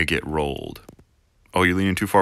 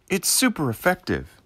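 much higher, excited game cry; 12 semitones higher than usual. Pretend. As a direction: squeal the verdict with glee. Pokémon battle text parody.

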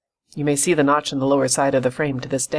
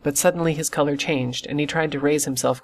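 usual speaking voice

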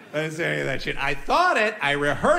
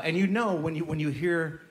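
ordinary delivery

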